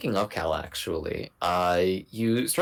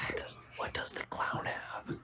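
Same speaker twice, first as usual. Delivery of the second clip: whispering; 10 dB quieter than usual. Real, not acted. Players whispering during stealth scene.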